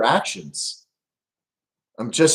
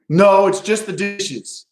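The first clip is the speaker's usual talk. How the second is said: higher, slow sulky line; sulky wife; 7 semitones higher, 3 dB louder, slower than usual. Retold or imitated. Wife brushing off husband's question, sulky. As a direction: pout it out.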